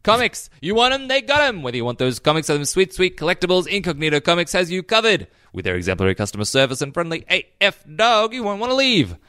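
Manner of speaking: punchy radio-commercial patter; ad read. Real, not acted. Host selling Incognito Comics to listeners, punchy ad read. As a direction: sell it big, lifting the key phrases. Reads the sponsor's ad.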